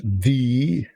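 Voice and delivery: THE said as "thee", long and drawn out; emphatic "thee". Shown as a demonstration. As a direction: stretch THE into a rising "thee". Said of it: "I don't know why people say that".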